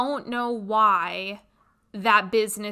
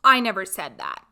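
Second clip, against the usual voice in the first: blunt retort; 2 semitones lower, 6 dB louder than usual. Retold or imitated. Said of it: "And my response was".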